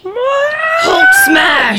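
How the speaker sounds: high, loud Hulk roar; Hulk shout. Retold or imitated. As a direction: bellow it like the Hulk. Imitating enraged big guy.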